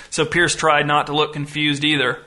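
plain reading voice

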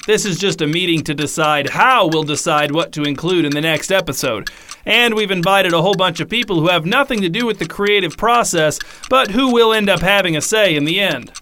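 ordinary talk

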